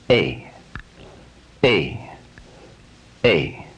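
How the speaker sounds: A driven from back to front; forceful A. Shown as a demonstration. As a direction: push A forward from the back. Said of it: "from the back, forward".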